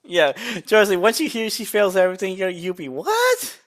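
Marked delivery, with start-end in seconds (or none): 2.9-3.6 high squeaky what; shocked what. Retold or imitated. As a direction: gasp out a stunned what. Shocked reaction in retold scene.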